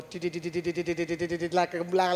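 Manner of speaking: rapid low stuttering computer noises; imitated hard-drive chatter. Preacher searching her memory for the man, imitated hard-disk chatter.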